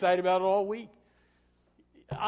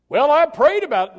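high, loud mocking voice of an excuser; mock excuse; 10 semitones higher, 16 dB louder than usual. Pastor mocks a churchgoer's excuse, high and loud. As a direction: toss off the lame excuse, hammed up.

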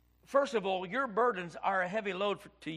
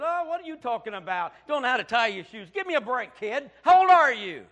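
scoffing, rising to a louder jab; mocking dismissal; 4 semitones higher, 10 dB louder than usual. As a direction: brush the kid off with scorn. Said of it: "Most people would have said".

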